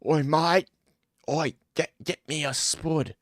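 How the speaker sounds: put-on British street accent; mock British accent. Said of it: "in Britain, you got".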